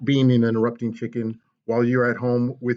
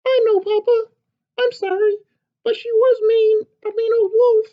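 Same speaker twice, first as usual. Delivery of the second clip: high, loud, eager chick's squeak; a tiny excited hen butting in; little chicken voice; 5 dB louder than usual. Acted. Chicken bursting into papa's story, high and eager.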